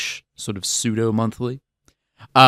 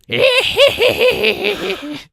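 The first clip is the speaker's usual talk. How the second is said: high cackling witch laugh; 5 dB louder than usual. Pretend. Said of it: "that's my witch laugh".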